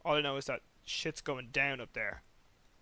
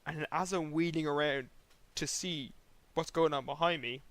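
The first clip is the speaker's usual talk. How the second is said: ordinary talk, nothing marked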